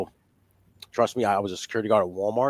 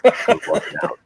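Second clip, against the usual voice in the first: mock panic; 12 semitones higher, 3 dB louder than usual. Imitates nervous father about toilet paper.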